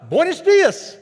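high loud Buenos dias; beaming greeting. Retold or imitated. Hotel attendant greeting guests, beaming and loud. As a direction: sing it out with a big smile. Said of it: "a big smile".

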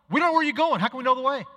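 high, loud, exasperated question; Thomas blurting out. Retold or imitated. Thomas speaks up when nobody else dares, loud and exasperated. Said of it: "Thomas says".

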